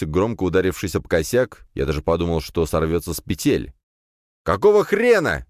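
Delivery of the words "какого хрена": loud, high furious yell; shouting. A narrator's reading. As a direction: yell at the door. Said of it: "закричал я". (гневный крик)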